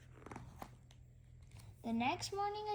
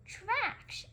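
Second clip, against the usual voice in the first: put-on excited child; 11 semitones higher than usual. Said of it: "she says".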